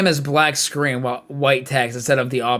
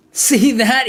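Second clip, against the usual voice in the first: high, loud gleeful cry; gleeful high shout; jolly cry; 9 semitones higher, 7 dB louder than usual. Acted. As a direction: crow with delight mid-dance. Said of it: "he cried".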